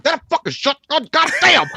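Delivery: high-pitched angry outburst, hammed up; mock pissed-off. Killer grabbing the shotgun, hammed-up pissed-off cursing. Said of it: "I bet he was pissed".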